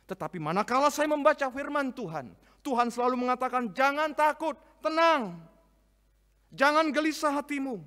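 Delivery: high, loud proclaiming; full-force preaching. Acted. Pastor proclaiming God's words against fear, fervent. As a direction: lift the congregation with it.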